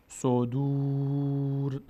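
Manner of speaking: slow, drawn-out chanted Arabic recitation; slow Quran recitation. Shown as a demonstration. Teacher slowly reciting the verse for the student, chanted. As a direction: stretch every syllable as in tajweed. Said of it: "teacher recites".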